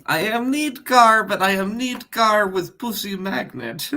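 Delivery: put-on Borat accent, rising high and dropping back; lilting mock Borat accent. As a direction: answer in a hammed-up Borat accent. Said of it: "Borat accent".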